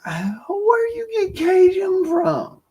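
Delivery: very high, slow, put-on voice of a curious listener; high, slow mock question from a listener. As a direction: squeak out the listener's question in a silly, drawn-out voice. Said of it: "Y'all might ask".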